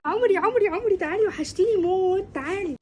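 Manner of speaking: squeal-happy greeting, calling your friend in for a hug; gushing affection. Acted. Effusive greeting of a missed friend. (ترحيب متحمس وملهوف)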